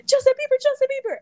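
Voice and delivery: loud, very high excited squeal; hammed-up squeal of fan hysteria; mock fangirl scream. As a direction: imitate a starstruck girl shrieking a name. Imitating a girl waking up starstruck.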